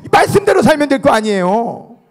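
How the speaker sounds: loud, high-pitched sermon call; rally the congregation to repeat after you; preacher ringing out each declaration; full-force preaching. Acted.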